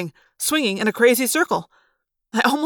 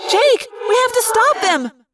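high, desperate character voice; very high, distressed plea; pleading cry; 13 semitones higher, 4 dB louder than usual. Acted. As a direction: cry out an urgent appeal. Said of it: "Cassie cried".

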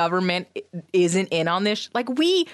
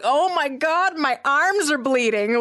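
panicked; 8 semitones higher, 3 dB louder than usual. Retold or imitated. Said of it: "and I was like".

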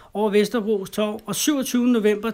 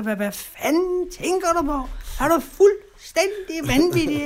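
high, slow, outraged scolding; imitating scolding daughter; 9 semitones higher, slower than usual. Daughter berating her drunk father, high slow outrage. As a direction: mimic her yelling at him, much higher and drawn out. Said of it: "så skældte hun mig ud".

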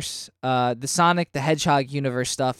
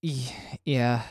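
low, soft, reluctant admission; downbeat, hesitant agreement; unhappy yeah; 4 semitones lower, 7 dB quieter than usual. Real, not acted. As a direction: agree reluctantly, clearly not happy about it. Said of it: "You don't sound happy about that".

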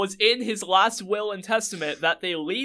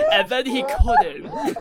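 laughing; 4 dB louder, slower than usual. Real, not acted. Laughing through punchline.

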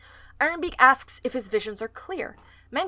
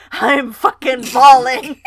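words pushed out through loud laughter; laughing; 3 semitones higher, 11 dB louder, slower than usual. Real, not acted. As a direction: laugh so hard it breaks the line. Laughing hard at the meme.

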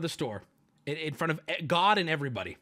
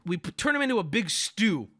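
normal talking voice

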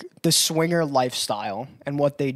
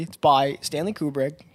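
ordinary talk

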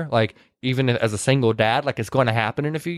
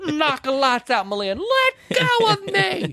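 slow, high mock cries; drawn-out theatrical yelp, climbing higher; hammed-up struggle; 13 semitones higher, much slower than usual. Retelling the fight over Weezer, hammed-up high cries. Acted struggle in retold scene.

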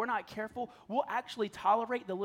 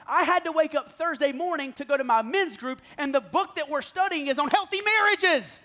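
higher, louder preacher's build; full-force preaching; 9 semitones higher, 8 dB louder than usual. Acted. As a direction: drive it home to the congregation.

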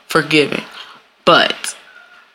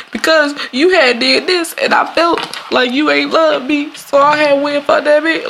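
excuse-making cheater; 9 semitones higher than usual. Retold or imitated. Cheater blaming partner's neglect, high whiny excuse. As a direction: whine the excuse like a guilty cheater.

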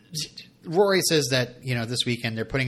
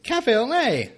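Cafe au lait much higher, stressed on Cafe, falling; high mock French; 11 semitones higher, 4 dB louder, slower than usual. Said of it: "me being angry with my notes".

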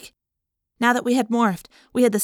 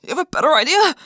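sharp retort; 7 semitones higher, 5 dB louder, faster than usual. A narrator's reading. Jake retorts under fire.

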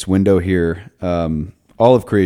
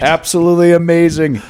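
gushing, much higher and louder; excited; 10 semitones higher, 5 dB louder than usual. Acted. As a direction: hype it up like a superfan. Over-the-top praise of the Nuggets.

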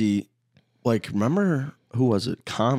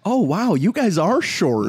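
mock amazement; 9 semitones higher, slower than usual. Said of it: "Did you say".

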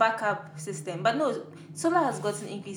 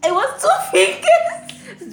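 high, loud laughing protest; 11 dB louder, much slower than usual. Real, not acted. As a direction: laugh while insisting. Laughing defence of gesture.

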